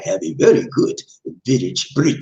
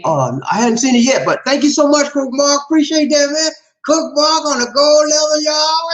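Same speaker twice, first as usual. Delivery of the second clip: high, loud excited shout-out; shouting thanks to a supporter; hyped thanks; 4 dB louder than usual. Real, not acted. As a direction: whoop it up for the donor.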